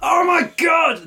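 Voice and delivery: high, slow terrified cry; panicked scream. Acted. Enemy panics at metal bird.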